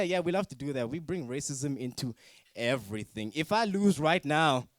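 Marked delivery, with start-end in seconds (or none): none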